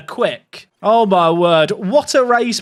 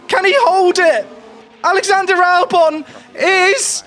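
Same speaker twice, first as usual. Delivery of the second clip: high, tense pleading then cheering; nervous excitement building to joy; excited cheering; 6 semitones higher than usual. Real, not acted. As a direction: beg for the win, then celebrate. Streamer watching his team win race.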